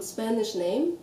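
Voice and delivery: usual speaking voice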